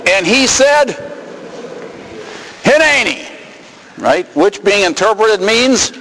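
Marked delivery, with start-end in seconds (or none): 2.6-3.3 eager answer. Acted. Moses answering, reading Hebrew.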